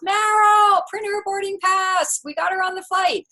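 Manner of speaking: high, loud call across the hall; retold yell. Agent calling to a colleague across the terminal, high shout. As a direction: holler the order so she hears it far off. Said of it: "he yells".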